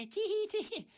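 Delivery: high, loud singsong with fake giggle; mock giddy. Ranter flubs and must restart recording, mock-gleeful squeal. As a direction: squeal it in sarcastic cheer. Mocks himself after flubbing a word.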